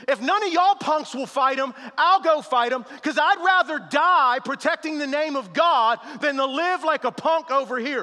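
loud, driving pulpit delivery; preaching at full force. Acted. Pastor rallying the congregation through David's words, preaching loud.

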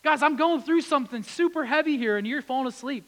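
Really? as a frustrated rebuke to sleepy friends; upset Jesus. Acted. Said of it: "And Jesus is upset".

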